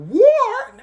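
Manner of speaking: very high, loud mock shriek; shocked disbelief. British government hearing talk of war, shocked. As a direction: squawk it in alarmed disbelief.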